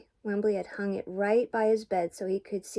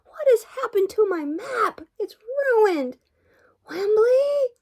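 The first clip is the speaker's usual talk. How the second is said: high, loud, outraged cries; shrieked dismay, pitched way up; upset yelling; 14 semitones higher, 6 dB louder, slower than usual. A narrator's reading. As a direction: wail in alarm at the damage. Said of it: "he yelled".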